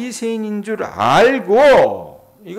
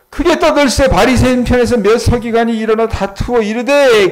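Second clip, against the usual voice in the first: scripture reading; 6 semitones higher, 8 dB louder than usual. Acted. Preacher reads scripture aloud.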